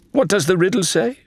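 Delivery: high, keen question; Faisal's eager voice. A narrator's reading. Faisal asks Adelaide for the riddle, eager and high. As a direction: ask it like an eager guide.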